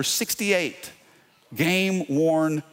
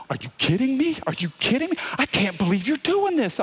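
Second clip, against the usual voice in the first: excited disbelief; 8 semitones higher than usual. Retold or imitated. Fan receives jersey.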